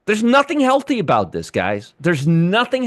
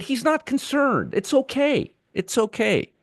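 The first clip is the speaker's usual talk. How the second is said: high, softer sarcastic soothing; mock reassurance; 5 semitones higher, 4 dB quieter than usual. Host mocks the unconcerned secretary to viewers, sarcastically reassuring. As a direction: pretend to reassure, voice lifted high in disbelief.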